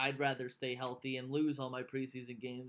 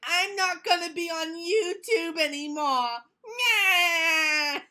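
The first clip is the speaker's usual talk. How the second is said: high, slow mock whine; whiny villain voice; 14 semitones higher, 10 dB louder, much slower than usual. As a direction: sulk it like a cartoon villain. Mocking villain quitting YouTube.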